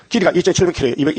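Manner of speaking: ordinary delivery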